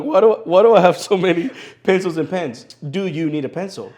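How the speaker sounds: imitating his baffled younger self; mock bewilderment. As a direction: play your confused young self. Retelling his confused young self.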